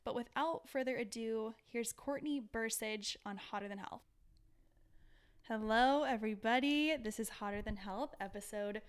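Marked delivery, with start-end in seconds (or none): 5.5-6.9 loud bright show opener; announcer greeting. Real, not acted. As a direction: boom out the welcome. Podcast host opening greeting.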